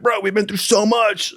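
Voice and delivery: high, choked-up retold plea; tearful pleading. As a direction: act out begging a buddy through tears. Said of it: "I teared up for the guy".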